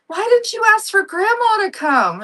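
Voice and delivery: high-pitched imitation of complaining kids; exaggerated high kid voice; kids' voice. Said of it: "the kids were like".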